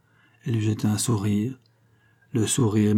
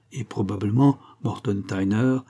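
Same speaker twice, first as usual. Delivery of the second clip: ordinary delivery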